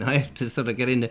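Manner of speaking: normal talking voice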